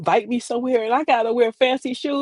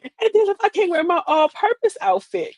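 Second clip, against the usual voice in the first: ordinary delivery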